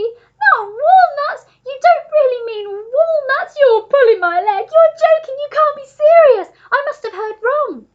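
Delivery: high, shrieking disbelief; frantic monkey at full scream. A narrator's reading. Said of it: "screamed the monkey".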